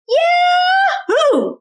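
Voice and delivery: joyful cowboy yell; shouting. Acted. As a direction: whoop it out with glee. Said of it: "shouted Bubba".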